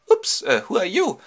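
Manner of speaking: put-on high, loud girlish question; startled girl. Nausicaa finds a stranger on the beach, high startled voice. Princess meets a stranger, acted.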